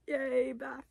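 high little self cheer. Real, not acted. Praising herself for the calls, high cheer. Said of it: "cheering herself on".

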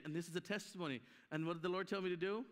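usual speaking voice

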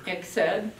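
ordinary delivery

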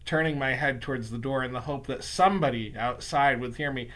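plain reading voice

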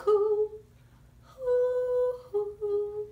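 put-on sung owl hoo for the children; owl hoot. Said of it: "he used his owl voice".

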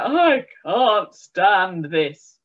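slow, drawn-out groan of complaint; weary Mister Bear. A narrator's reading. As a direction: drag it out like a tired, fed-up bear.

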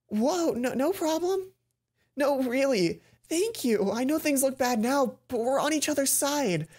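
Mai voice. Acted.

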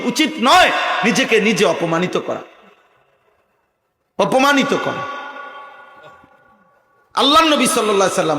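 forceful scripture quoting and exhortation; emphatic preaching. Real, not acted. Preacher warns believers against degrading themselves, emphatic preaching. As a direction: drive the warning home, pausing before the repeat.

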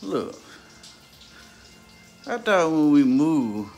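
ordinary talk, nothing marked

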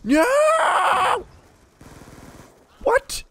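very high, loud squealing panic; screaming no as the enemy attacks; panicked scream. Real, not acted. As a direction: shriek as they catch you. Caught by enemy.

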